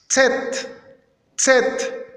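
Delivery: "Zet" high, starting on a sharp ts; accented TS. Shown as a demonstration. Teacher modelling the letter's name, accented TS. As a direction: hit "Zet" short, with ts up front. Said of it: "accentuer le T S".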